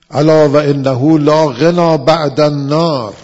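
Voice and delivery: slow, loud chanted Arabic; sermon chant of a holy text; scripture recitation. Acted. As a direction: intone the verse like a preacher.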